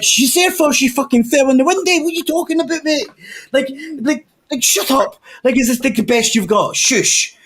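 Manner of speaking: high, cheeky mock retort; mocking the pimp. Telling off the angry pimp, high mocking retort. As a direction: brush him off with high scorn.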